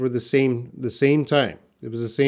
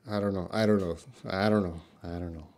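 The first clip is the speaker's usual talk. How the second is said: 1 embarrassed mumble; 7 semitones lower, 11 dB quieter, much faster than usual. Real, not acted. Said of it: "I don't know how British people would say that".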